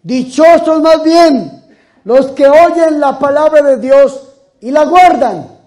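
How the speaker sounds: high, loud, very slow solemn correction; Jesus answering the woman, solemn and loud; Jesus replying. Acted. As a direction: pronounce it as a firm teaching. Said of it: "Y el Señor dice".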